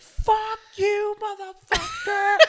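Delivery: loud drawn-out falsetto curse; imitated angry girl. Girl cursing him as he walks by, slow mocking falsetto. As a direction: squeal abuse as a hammed-up furious girl. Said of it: "she's like".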